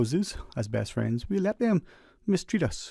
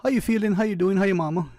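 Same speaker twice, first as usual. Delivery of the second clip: breezy empty greeting; 7 semitones higher, 4 dB louder, faster than usual. Retold or imitated. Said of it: "many of us will ask".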